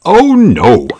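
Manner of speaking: dismayed. A narrator's reading. Narrator reacts to fall.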